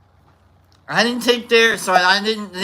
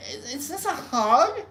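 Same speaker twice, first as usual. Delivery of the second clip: high wondering excitement; 9 semitones higher than usual. Retold or imitated. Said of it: "I'm like".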